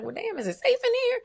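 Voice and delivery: high, fast, squeaky mock worry; mock uneasy client. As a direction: play yourself half-panicked about safety. Retold self, uneasy client.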